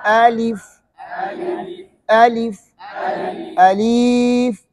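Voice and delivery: الف with the vowel drawn out very long; wrongly stretched alif. Shown as a demonstration. Teacher to children: wrong, over-stretched الف. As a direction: drag out الف as a wrong example. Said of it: "بعض لوگ ایسے پڑھتے ہیں نا، یہ صحیح".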